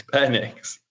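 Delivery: Penix said as PEN-iks; short-e pronunciation. Shown as a demonstration. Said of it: "address the pronunciation".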